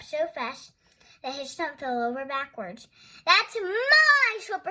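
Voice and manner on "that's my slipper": high, loud, drawn-out outraged cry; cried out. Acted. Thorndyke crying out over his stolen slipper, loud and outraged. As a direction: wail it like your treasure was stolen. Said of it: "he cried".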